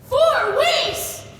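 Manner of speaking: loud, high disbelief; loud high startled echo; shocked. Acted. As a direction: blurt it out in shock. Shocked at the four weeks.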